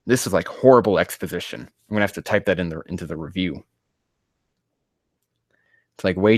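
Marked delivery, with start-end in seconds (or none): none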